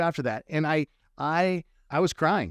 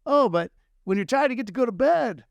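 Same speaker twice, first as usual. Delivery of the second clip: copying [name]; 8 semitones higher, 3 dB louder than usual. Retold or imitated. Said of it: "and I'm like".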